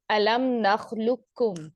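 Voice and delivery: slow tajweed recitation; tajweed recitation, drawn out; Quranic recitation. Shown as a demonstration. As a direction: draw the verse out slowly as in recitation. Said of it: "recites the single Quranic example".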